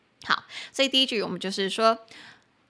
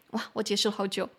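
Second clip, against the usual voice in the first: ordinary delivery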